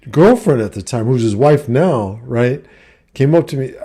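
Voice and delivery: ordinary talk, nothing marked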